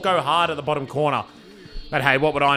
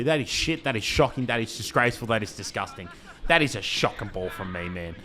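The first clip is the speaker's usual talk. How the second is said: self-scolding; 8 semitones lower, 7 dB quieter than usual. Real, not acted. Owner scolding his own bad pass, fading lower and softer. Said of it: "I'm going to take responsibility".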